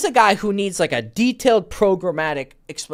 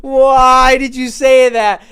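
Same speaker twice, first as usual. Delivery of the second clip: mock-anguished wail, slow, high and loud; dismayed cry; 8 semitones higher, 10 dB louder, much slower than usual. Coach reacting to client's stupid remark to a girl, dismayed. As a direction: stretch the question out in exaggerated despair.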